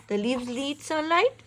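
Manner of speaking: plain reading voice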